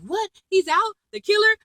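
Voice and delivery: high-pitched shocked questioning, imitated; mock freak-out. David Rosen hearing the killer is out, mock freak-out. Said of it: "David Rosen freaks out".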